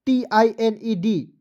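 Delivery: ordinary delivery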